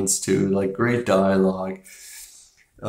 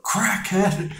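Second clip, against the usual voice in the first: mock yell; 12 semitones higher than usual. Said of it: "yelled out".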